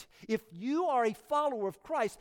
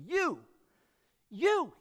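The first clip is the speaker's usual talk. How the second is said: "You, you" repeated with a high, insistent push on each "you"; emphatic you; 3 semitones higher, 3 dB quieter than usual. Retold or imitated. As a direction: hammer home the word "you" twice. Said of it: "Jesus is emphatic".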